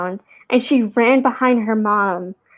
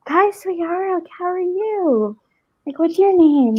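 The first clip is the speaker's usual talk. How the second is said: put-on higher, sing-song voice for a little kid; greeting the little girl who came back, higher; talking to a toddler; 7 semitones higher, slower than usual. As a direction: greet a small child gently. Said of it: "And I was like".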